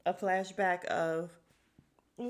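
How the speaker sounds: ordinary talk, nothing marked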